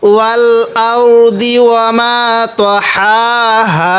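slow, drawn-out tajweed chant; Quran recitation. Shown as a demonstration. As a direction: recite each word slowly with long vowels. Teacher recites verse after spelling.

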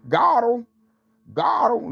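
high mocking "got him" taunt; that one voice. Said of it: "in that one voice".

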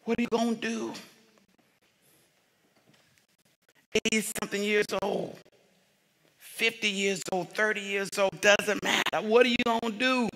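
quiet intimate sermon passage; hushed preaching. Acted. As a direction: draw them in quietly.

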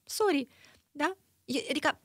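high, mocking put-on voice, a touch sarcastic; mock reply. A mock reply pitched high to the driver who stops mid-road.

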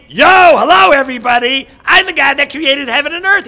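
loud, high shouted greeting; exaggerated announcement to a crowd; mock God introduction. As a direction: holler it as a hammed-up divine hello. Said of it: "God didn't say".

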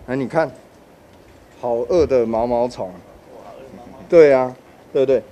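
loud, lively picture-book reading; reading to a toddler. Shown as a demonstration. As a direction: draw a small child into the book. Demonstrates reading picture book to kids. (给小朋友讲绘本的语气)

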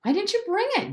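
very high accusing yell; high-pitched indignant demand. A narrator's reading. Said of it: "Jem yelled".

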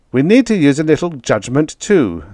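sharp, clipped retort; snapped. A narrator's reading. The Major snapping at a reckless driver. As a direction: snap back at him, irritated. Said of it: "the Major snapped".

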